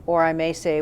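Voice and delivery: ordinary talk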